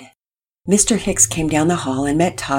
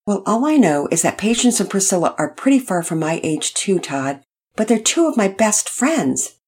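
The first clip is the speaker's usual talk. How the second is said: ordinary delivery, nothing marked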